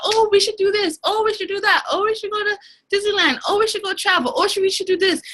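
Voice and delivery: high, eager mock voice of the partner; imitating her partner. Partner gushing about plans, in a high mock voice. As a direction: mimic her gushing ideas, pitched high. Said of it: "always talking about".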